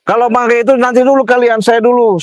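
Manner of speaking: high, agitated arguing voice; worked-up counter-threat; heated warning. Real, not acted. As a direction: warn him off, pitch held high. Quarrel, warning the man blocking him.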